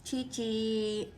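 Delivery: ordinary talk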